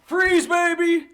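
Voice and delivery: high, loud, slow stadium cheer. Real, not acted. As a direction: shout it like a fan. Cheering a player's name.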